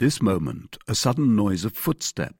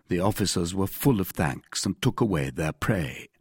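usual speaking voice

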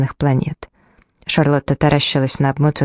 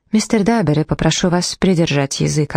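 usual speaking voice

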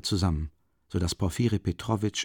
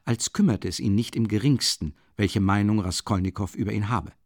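ordinary talk

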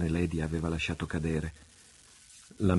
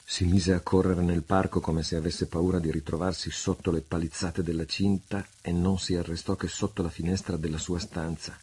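ordinary delivery

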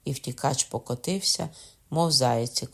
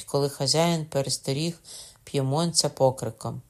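ordinary delivery, nothing marked

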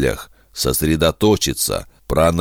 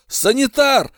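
calling out. Acted. Calling for medic.

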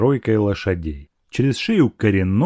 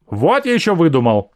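higher, indignant retort; lashing out; 6 semitones higher than usual. A narrator's reading. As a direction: round on him indignantly. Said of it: "накинулась на него Аграфена". (с возмущением)

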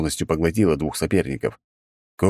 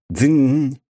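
metal clang; 8 semitones higher, 4 dB louder than usual. A narrator's reading. Harder hit on helmet.

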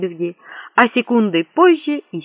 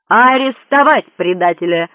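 loud, commanding roar; roared order; 6 dB louder than usual. A narrator's reading. Prince ordering an arrest, roaring. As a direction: bellow the order to the guards. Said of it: "взревел Горбуль".

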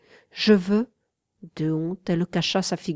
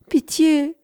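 high, louder wailing cry for pity; moaning plea; 8 semitones higher, 5 dB louder than usual. A narrator's reading. The lover moaning to Wanda for pity, wailing plea. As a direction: wail it out. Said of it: "dis-je en gémissant".

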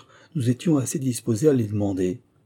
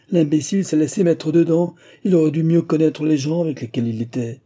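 higher, louder scolding; wife's raised-voice outburst; indignant railing; 6 semitones higher, 5 dB louder than usual. A narrator's reading. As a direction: rail at the fool's gullibility. Wife rails at duped son.

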